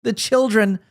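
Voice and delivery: mock her tearful apology; hammed-up tearful imitation; mock sobbing. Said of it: "she sobbed".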